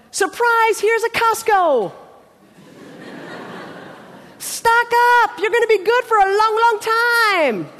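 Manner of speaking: hammed-up big surprise, held high and stretched; over-the-top excited announcement. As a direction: announce it like a grand prize. God mimicked as excited store host.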